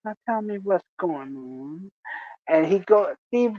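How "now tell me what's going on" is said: very soft, coaxing murmur; mock-tender consoling. Antoine cradles his coworker's hand, soft mock-tender coaxing. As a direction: coax him gently, lifting at the end. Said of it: "he's literally cradling Steve's hand".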